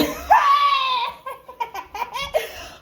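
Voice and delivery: long, high-pitched cackling laugh; high burst of laughter. Real, not acted. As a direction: crack up helplessly at your own accent. Cracks up at own accent.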